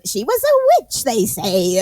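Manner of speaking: hammed-up spooky tour guide voice; weird ghost-tour voice. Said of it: "talking in a weird voice".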